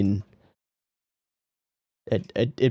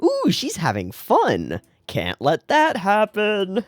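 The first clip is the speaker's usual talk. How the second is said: mock villain; 8 semitones higher, slower than usual. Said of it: "Can we go make her life miserable?".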